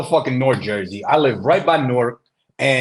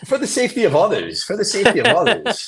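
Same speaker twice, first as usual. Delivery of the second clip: laughing; 10 semitones higher, 5 dB louder than usual. Real, not acted. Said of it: "joking repeat".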